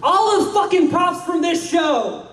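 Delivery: slow, emphatic shouted reveal. Acted. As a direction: bellow it out slowly. Announcing prop lot.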